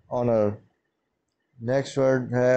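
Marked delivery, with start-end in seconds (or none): 0.1-0.6 ऑनर starts on the vowel, no h; ऑनर with the h left silent. Shown as a demonstration. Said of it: "इसलिए हम इसको ऑनर पढ़ेंगे". (ऑनर बिना एच की आवाज़ के)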